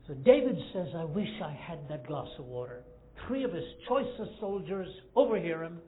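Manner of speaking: loud, driving sermon storytelling; preaching at full force. Acted. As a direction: pour it out to the congregation. Preacher builds the David story.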